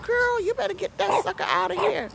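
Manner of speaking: high insistent order; bossy demand. Retold or imitated. Ordering the neighbor to remove the snake, high and insistent. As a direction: tell her firmly to get rid of it. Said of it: "I said".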